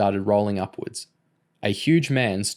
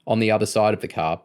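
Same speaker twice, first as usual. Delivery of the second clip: plain reading voice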